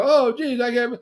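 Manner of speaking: high, loud mock whining; hammed-up hurt at being refused; dismayed husband. Acting whiny rejected young husband.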